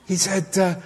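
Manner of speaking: ordinary delivery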